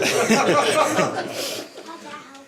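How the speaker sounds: long high laugh; big laugh. Real, not acted. Recalling Andy with no hat, a long laugh. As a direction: crack up at the memory. Laughs at his own story.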